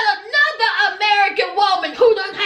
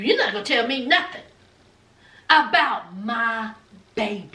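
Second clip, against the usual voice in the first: low protective snap; 7 semitones lower than usual. Retold or imitated. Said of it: "I can show you better than I can tell you".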